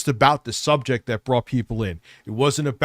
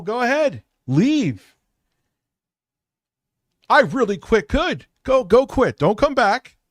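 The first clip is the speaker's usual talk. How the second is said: angry outburst; 11 semitones higher, 3 dB louder than usual. Real, not acted. Heated argument with co-host.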